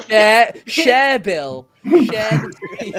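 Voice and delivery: high, loud playful shout; laughing demand. Real, not acted. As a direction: holler it through laughter, stretching it out. Host playfully ordering guest to share.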